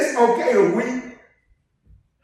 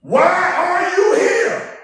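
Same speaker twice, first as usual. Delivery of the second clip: slow, loud, high demand; preacher's booming challenge; rebuking shout; 11 semitones higher, 6 dB louder, much slower than usual. Acted. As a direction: hurl the question at them. Preacher rebukes unmoved listener.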